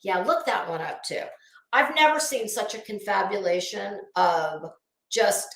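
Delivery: ordinary talk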